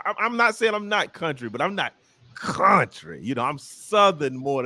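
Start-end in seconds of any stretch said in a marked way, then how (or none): none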